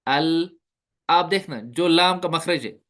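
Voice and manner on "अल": अल with the tongue sliding a little off its spot; lam with slight slip. Shown as a demonstration. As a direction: say अल so the ल slips slightly. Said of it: "हम पढ़ेंगे हमजा लाम जबर अल". (अल, ज़ुबान थोड़ी फिसलती हुई)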